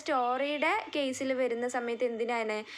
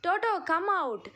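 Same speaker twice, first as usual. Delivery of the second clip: higher-pitched storybook calling out; mild yell; 6 semitones higher, much slower than usual. A narrator's reading. Dorothy telling hidden Toto to come out, mild yell. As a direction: call out lightly, as if to a hiding pet. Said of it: "Dorothy yelled out".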